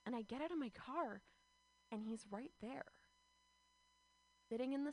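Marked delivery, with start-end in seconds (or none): none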